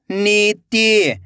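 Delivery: नीति joined as one slow word; blended word. Shown as a demonstration. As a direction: read नीति whole, stretching the नी. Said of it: "खींच के पढ़ना है".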